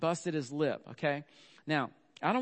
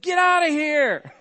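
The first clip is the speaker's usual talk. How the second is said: much higher and louder, a full shout despite claiming calm; mock-calm yell; 13 semitones higher, 16 dB louder, slower than usual. Dad yelling at his panicking wife over the bathtub, shouting. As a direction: bark it out slowly while pretending to be serene. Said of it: "I very calmly, like a godly man should".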